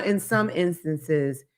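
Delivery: normal talking voice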